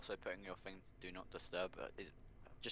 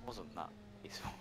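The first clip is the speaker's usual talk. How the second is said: whispered; 11 semitones higher, 5 dB quieter than usual. Real, not acted. Ironic aside about chaotic race.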